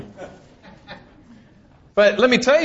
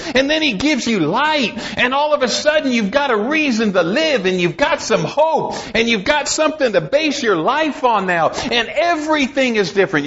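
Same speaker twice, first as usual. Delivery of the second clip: impassioned preaching; 6 semitones higher than usual. Acted. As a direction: preach it out, lifting the congregation. Preaches with rising fervor.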